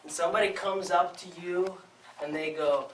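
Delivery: ordinary talk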